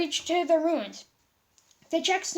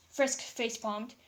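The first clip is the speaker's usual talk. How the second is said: plain reading voice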